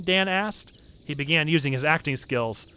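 usual speaking voice

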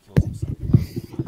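loud jokey yell. Real, not acted. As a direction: shout it out while he's away. Matt away, yelling.

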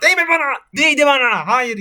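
sudden high, loud shout at the game; real yell. Real, not acted. Gamer yelling at a laser while playing, high loud shout. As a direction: yell in alarm as the laser hits. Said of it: "videolarda çok bağırıyorum".

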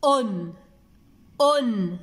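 أن said alone as a firm un, falling; isolated syllable. Shown as a demonstration. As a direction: repeat أن by itself with a pause between. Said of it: "أين سمعتم صوت الهمزة".

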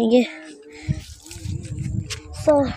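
ordinary talk

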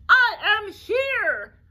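drawn-out dragon roar. Acted. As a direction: roar it so she knows you came. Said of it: "roared Dragon".

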